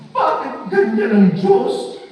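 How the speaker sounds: angry complaint. Retold or imitated. Angry woman complaining to the preacher, mock shouted. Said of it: "galit na galit kay Lord".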